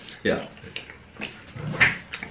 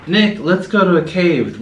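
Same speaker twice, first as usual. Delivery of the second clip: higher, drawn-out eager invitation; eager suggestion; 7 semitones higher, 3 dB louder, slower than usual. Retold or imitated. Retelling how they coaxed a partner into a cave trip, eager. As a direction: pitch an outing with retold eagerness.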